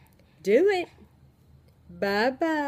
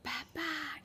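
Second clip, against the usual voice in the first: imitating baby; 6 semitones higher, 15 dB quieter than usual. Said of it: "and he said".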